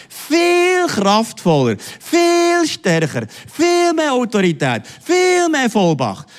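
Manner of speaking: very slow, high, stretched viel; preaching at full force. Acted. Preacher explaining the Greek word, fervent. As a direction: draw out each viel with power.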